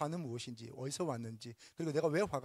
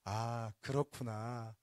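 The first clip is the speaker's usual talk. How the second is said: slow reassuring demo voice; calm understanding; 4 semitones lower, slower than usual. Shown as a demonstration. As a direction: let each word settle gently. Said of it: "이렇게 말씀하시면 좋겠습니다".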